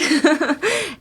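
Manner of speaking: short high giggle; a little laugh at herself; quick light laugh. Real, not acted. Teacher laughs while drilling don't ask.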